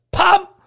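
sudden loud, high pop; imitated pop. Driver telling how the belt went, explosive pop. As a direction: punch out the noise of something snapping.

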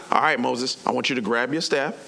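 low, composed voice of God; lightly voiced, unruffled authority; calm God. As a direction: answer patiently, pitched well down. God answering the ranting Moses.

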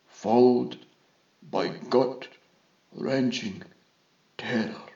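slow, halting list with long pauses; measured diagnosis, stage by stage, softly voiced; confident vizier. A narrator's reading.

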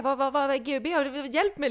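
loud, high, frantic pleading; retold loud panic; panicked cry for help. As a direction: beg for help in a rising panic. Retelling her panic at party.

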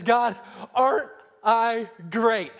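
loud, drawn-out boast to God; boastful Pharisee. Acted. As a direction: hold it up proudly, loud and slow. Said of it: "he holds it up, and he says".

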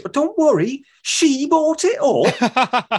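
put-on high, very slow northern accent with a stretched "bought it up"; drawn-out northern English accent; imitating Daphne's northern accent. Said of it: "imitating it".

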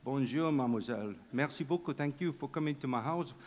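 low, soft, badly faked French accent; mangled French accent, kept quiet; butchered French accent. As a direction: play a hammy French host, voice dropped low. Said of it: "a very butchered French accent".

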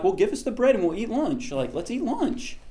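high-pitched, obtuse crowd voice; mock eager crowd. Hungry crowd still asking Jesus for lunch, mock eager.

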